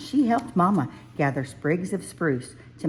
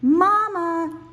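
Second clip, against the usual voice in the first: high, loud call across the forest; 14 semitones higher, 5 dB louder than usual. A narrator's reading. Cub searching the forest for Mama, calling high and loud. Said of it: "Small Bear called".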